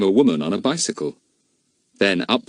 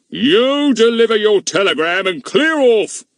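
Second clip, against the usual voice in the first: grumpy policeman; 14 semitones higher, 6 dB louder than usual. A narrator's reading. Goon orders boy away.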